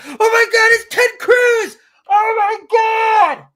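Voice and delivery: hammed-up gushing fan shout; mock fangirl shriek. Mocks starstruck fan reaction.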